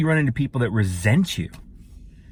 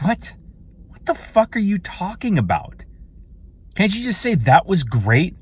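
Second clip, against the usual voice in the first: exasperated rant; 7 semitones higher, 3 dB louder than usual. Real, not acted. Ranting against negative talk.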